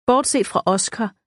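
ordinary delivery

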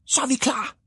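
high-pitched little hero calling out; small character's high, excited call; eager call. A narrator's reading. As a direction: pipe up high, ready to go. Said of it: "råbte han".

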